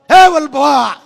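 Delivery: loud, sharp call of હે Vallabha with the final આ stretched; a wife yelling her husband's name in a temper; wife's angry call. Retold or imitated. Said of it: "પત્ની કેટલી મૂડમાં છે". (ગુસ્સામાં પતિને મોટેથી બોલાવતી પત્ની)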